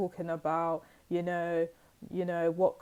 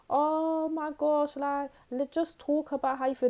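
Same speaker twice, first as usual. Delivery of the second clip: mock-gentle worried family; 8 semitones higher than usual. Family coaxing moody teen.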